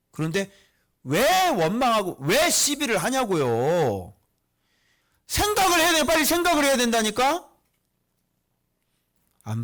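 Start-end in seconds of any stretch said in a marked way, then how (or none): 1.0-7.5 loud, high-pitched urgent exhortation; impassioned preaching. Real, not acted. Preacher challenging congregation over their grumbling, loud impassioned exhortation. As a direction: drive each question home at full force.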